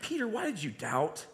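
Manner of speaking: reproachful, pointed doubt question; a sharp why, let down by him; the tone Jesus perhaps did not use, annoyed. Shown as a demonstration. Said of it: "the tone was not".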